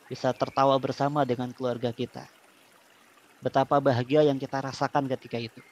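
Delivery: calm guided breathing voice; soothing spa whisper. Acted. Leads relaxation therapy.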